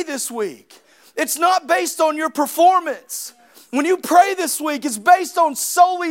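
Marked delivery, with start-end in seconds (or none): 1.1-3.3 loud, raised preaching to the congregation; full-force preaching. Acted. Pastor urging his church on prayer, preaching loudly. 3.7-4.8 When you pray this week, pushed louder on you; louder repeat. Acted. Pastor repeating the line to the church, louder. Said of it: "repeated louder".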